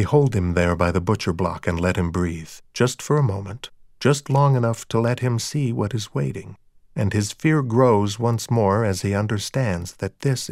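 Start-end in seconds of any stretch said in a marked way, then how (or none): none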